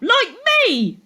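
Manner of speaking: put-on show-off boy boasting to the class; boastful call. A narrator's reading. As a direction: crow it proudly. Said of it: "Kevin called out".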